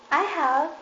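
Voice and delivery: high, protesting cry. A narrator's reading. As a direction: blurt it out in protest. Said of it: "cried the little rabbit".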